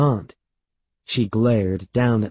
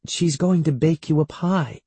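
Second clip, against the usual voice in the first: higher-pitched, lightly voiced mother; coaxing mother; 7 semitones higher than usual. A narrator's reading. Mother coaxing her upset son, higher and reassuring. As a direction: offer it as encouragement.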